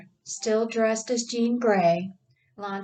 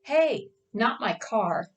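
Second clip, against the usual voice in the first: scolding; 2 semitones higher, 4 dB louder than usual. Acted. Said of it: "Travis scolded".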